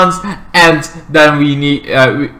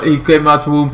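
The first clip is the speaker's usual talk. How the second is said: ordinary delivery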